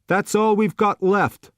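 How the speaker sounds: bored waiter pushing the last dish; gruff waiter. A narrator's reading. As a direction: waiter to customer, impatient put-on voice. Surly waiter voiced.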